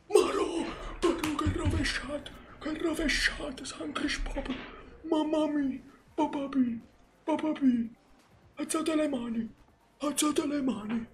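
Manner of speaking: high, excited mock commentator cry; breathless italian goal commentary.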